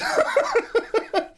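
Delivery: squeaky high burst of laughter. Real, not acted. Streamer cracks up at the Daily Mail news, high laugh. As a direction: laugh in disbelief.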